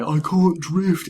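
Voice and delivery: high, slow, drawn-out whine; mock complaint. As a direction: imitate frustrated players moaning. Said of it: "people that get mad".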